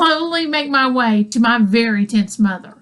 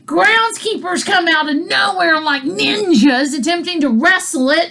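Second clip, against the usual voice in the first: normal talking voice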